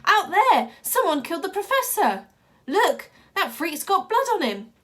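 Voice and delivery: high, loud shout from outside; calling out. Acted. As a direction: yell across the crowd. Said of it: "another voice called".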